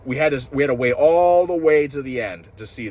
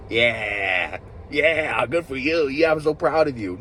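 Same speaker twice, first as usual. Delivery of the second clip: higher, sarcastic "so proud of you"; mock sarcastic praise; 4 semitones higher, slower than usual. Reviewer mocking a character congratulating the kids, sarcastic. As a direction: sneer the praise like a smug villain. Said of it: "Boy Cavalier was like".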